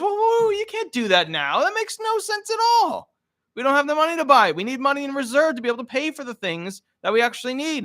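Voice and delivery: very high, fast mock wife voice; a wife telling her husband they can't afford it; exasperated wife. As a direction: lecture him about reserves. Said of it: "And she goes".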